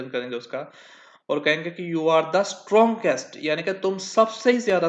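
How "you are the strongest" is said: slow dictation. Shown as a demonstration. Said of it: "teacher dictating example sentence".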